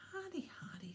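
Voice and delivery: patronising, shaking his head; impatient. A narrator's reading. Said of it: "shaking his head impatiently".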